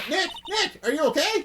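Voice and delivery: high, anxious call; worried calling out. Acted. Maya calling to Nick after the tiger attack, alarmed.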